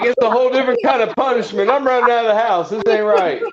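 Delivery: high, fast and laughing, mock-fleeing; laughing panic. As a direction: cackle through a pretend escape from the house. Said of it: "You're like".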